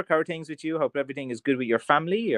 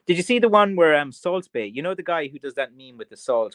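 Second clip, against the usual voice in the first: ordinary delivery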